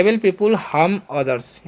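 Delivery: very slow, word by word; slow classroom dictation; slow example sentence. Shown as a demonstration. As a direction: spell it out slowly for the class. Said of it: "तो बोलेंगे".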